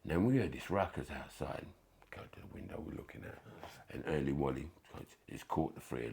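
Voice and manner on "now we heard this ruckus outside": ordinary talk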